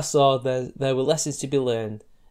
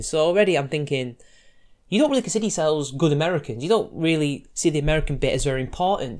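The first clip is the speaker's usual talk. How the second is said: ordinary talk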